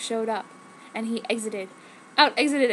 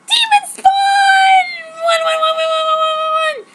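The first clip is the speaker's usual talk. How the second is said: screaming; 10 dB louder than usual. A narrator's reading. Girl shrieking at the vampire boy, full-throated scream. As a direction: hurl it in a piercing scream. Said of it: "I screamed".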